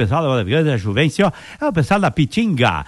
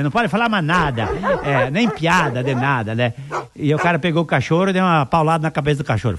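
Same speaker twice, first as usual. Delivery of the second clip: ordinary talk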